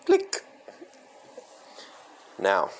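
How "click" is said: high voiced click noise; mouth click sound. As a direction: say click like a hang-up. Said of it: "we're hanging up".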